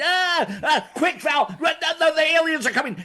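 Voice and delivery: high, fast hammed-up panic; mock panic. Retelling the alien movie scene, hammed-up panic. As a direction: play-act fleeing in a frenzy. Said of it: "I'm panicking".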